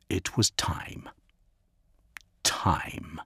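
whispered, hushed thought. A narrator's reading. The quarry whispering to himself in the dark, hushed. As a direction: breathe it to yourself.

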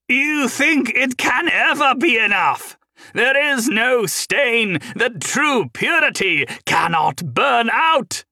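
high, slow, loud manic shouting; screaming wildly. Acted. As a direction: spit out the words in mania. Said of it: "Hendren screamed wildly, spitting mania".

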